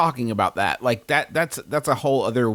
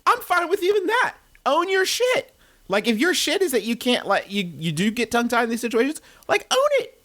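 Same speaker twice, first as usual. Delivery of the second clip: much higher, insistent urging; emphatic exhortation; 10 semitones higher than usual. Real, not acted. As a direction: push it up high and urge them hard.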